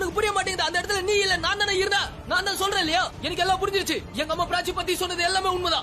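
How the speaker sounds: pitched well up, heated and insistent; furious rant at his friend; angry outburst. Acted. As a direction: rant at your friend in frustration.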